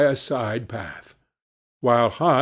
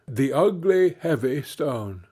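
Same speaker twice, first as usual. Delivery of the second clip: plain reading voice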